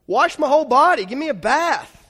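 mock-eager, wanting it all; hammed-up eager disciple; eager Peter. Peter begging Jesus for washing.

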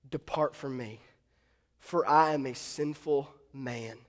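low, soft confession; hushed, halting humility; sobered, humbled. Acted. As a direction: kneel in awe and confess unworthiness. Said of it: "he sorta gets his bearings".

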